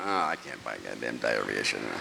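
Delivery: grumbling in embarrassment at the counter; embarrassed mutter. Retold or imitated. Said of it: "I went".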